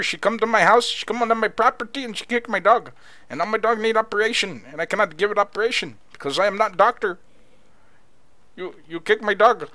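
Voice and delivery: aggrieved, hammed-up Indian accent; mock Indian accent. Dog owner complaining about the neighbour's daughter, mock Indian accent. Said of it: "Where the heck is that accent from?".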